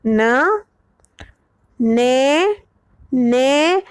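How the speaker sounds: ने with a straight e; pron demo. Shown as a demonstration. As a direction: sound out न, then ने. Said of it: "बच्चा ने बोल रहा है".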